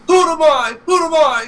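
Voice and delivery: very high, loud cartoon voice; retold taunting demand. Retelling Luigi landing on Mario, high loud mock demand. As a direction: imitate a triumphant cartoon demand. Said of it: "he's like".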